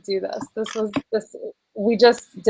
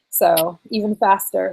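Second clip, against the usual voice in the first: ordinary delivery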